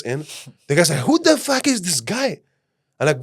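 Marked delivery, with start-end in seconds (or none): 1.1-2.4 high, slow, outraged disbelief; stunned disbelief. Retold or imitated. The guy watching them all get in, stunned and high-pitched. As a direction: draw it out, high and amazed.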